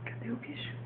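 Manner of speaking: soft hushed question; whispering. Real, not acted. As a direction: whisper it with a playful lift. Sussurra ao bebê brincando.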